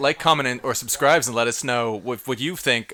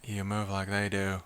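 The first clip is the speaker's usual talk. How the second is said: emotionless monotone; 5 semitones lower, 8 dB quieter than usual. Shown as a demonstration. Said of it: "Who can say with the least amount of emotion".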